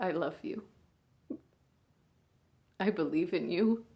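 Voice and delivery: a big sister at a hospital bedside, swinging between hushed and raw; hushed, aching bedside monologue; grieving big sister. Acted. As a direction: speak to your unconscious little sister, holding back tears. Sister talks to comatose sibling.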